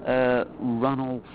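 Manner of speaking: slow, careful "Ranulph de Alfreton"; careful pronunciation. Real, not acted. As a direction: sound out each name slowly. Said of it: "I'm hoping I'm gonna pronounce this correctly".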